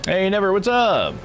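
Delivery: usual speaking voice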